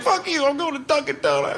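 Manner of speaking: high, sudden mock fury; mock angry outburst. Comic storming off from Starbucks, mock angry high outburst. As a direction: snap at the barista in mock rage. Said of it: "and I was like".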